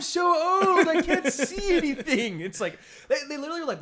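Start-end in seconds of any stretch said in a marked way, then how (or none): 0.0-2.4 loud, high, slow drawn-out lament; hammed-up cry of someone suddenly old; mock anguished wail. Character of Old losing sight, mock wailing. Mocking the film's ageing complaints.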